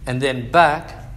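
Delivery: loud preaching; preaching God's words. Acted. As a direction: proclaim it like a sermon peak.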